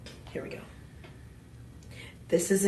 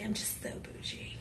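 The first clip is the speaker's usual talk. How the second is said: whispered joke; 19 dB quieter than usual. Real, not acted. Joking about her bougie word.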